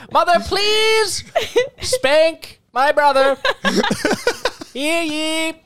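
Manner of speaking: high, slow, laughing herald cry; town crier voice. Retold or imitated. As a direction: proclaim like a royal crier. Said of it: "I'm not even gonna try to do that voice".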